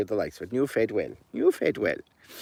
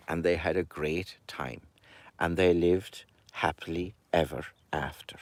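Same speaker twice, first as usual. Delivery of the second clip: hushed ending; 6 semitones lower, 11 dB quieter than usual. A narrator's reading. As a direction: whisper it, much softer. Story ending whispered.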